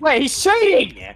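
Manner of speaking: high, slow outraged cry; raging gamer. Real, not acted. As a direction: accuse him at full volume. Teammate raging at killer.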